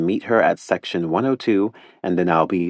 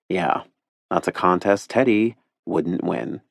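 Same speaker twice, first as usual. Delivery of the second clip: usual speaking voice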